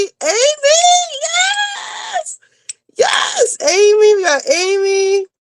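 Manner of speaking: very high, shouted and tearful; shouting out each Patreon member with joy; ecstatic roll call. Real, not acted. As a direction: cheer each name as it comes. Listing her new members.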